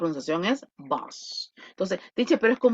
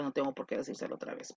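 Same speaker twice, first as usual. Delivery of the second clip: under the breath aside; 7 semitones lower, 12 dB quieter, much faster than usual. Real, not acted. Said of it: "aside to students".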